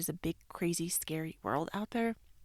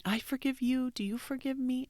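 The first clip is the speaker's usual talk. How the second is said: forgiveness ritual; 7 semitones higher than usual. Retold or imitated. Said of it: "we always do this".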